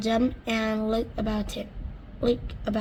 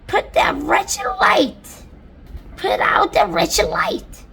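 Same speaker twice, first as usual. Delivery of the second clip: high, slow, loud yell; shouted; 11 semitones higher, 8 dB louder, slower than usual. Acted. Centipede yelling at the glowworm to put out the light, high loud shout. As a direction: bellow it up at the ceiling. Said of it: "he shouted".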